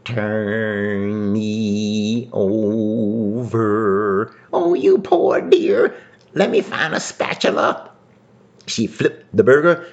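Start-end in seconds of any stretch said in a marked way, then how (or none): 0.1-4.3 very slow, drawn-out burger voice; ghostly moan. Acted. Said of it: "The hamburger said". 4.5-7.9 put-on high old woman voice. Old woman answers burger.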